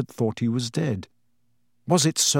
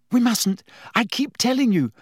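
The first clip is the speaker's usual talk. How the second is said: put-on much higher, urgent girl's voice; exasperated Hermione; 10 semitones higher than usual. A narrator's reading. Hermione refusing Harry's plan to move. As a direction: scold him, you've said it before.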